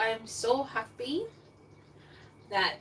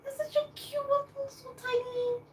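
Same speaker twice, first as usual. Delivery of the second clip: much higher, cutesy voice; cooing. Real, not acted. Vlogger cooing to viewers over a tiny apple.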